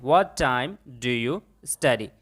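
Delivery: very slow, word by word; slow model sentence. Shown as a demonstration. Teacher shows students the English, very slowly. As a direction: spell it out slowly for learners. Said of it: "आप कितने बजे पढ़ते हैं?".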